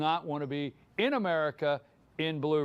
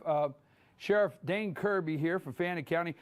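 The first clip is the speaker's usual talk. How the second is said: ordinary talk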